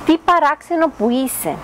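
a hint of mocking wonder; the hut folk mocking. A narrator's reading. The hut folk taunting the duckling, light mock scorn. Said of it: "του έλεγαν".

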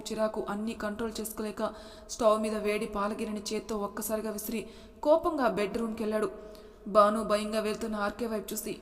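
plain reading voice

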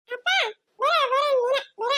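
high pleading call; put-on calling voice. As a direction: call them over in a squeaky character voice.

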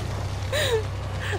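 nervous squealing laugh; panicked high laugh. Real, not acted. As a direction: laugh through the fear. Nervous laugh while chased.

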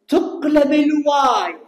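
loud, slow, stressing تقلب; emphatic call for attention. Shown as a demonstration. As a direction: hammer home the rule so students notice. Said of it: "انتبهوا وياي،".

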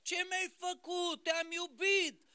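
a man who has lost everything and screams his grievance upward, pitched high; pretend raging at God. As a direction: rail at God like a betrayed believer. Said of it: "n-a urlat, n-a zbierat la Dumnezeu".

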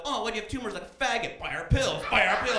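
mock pushy salesman. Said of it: "they wouldn't be like".

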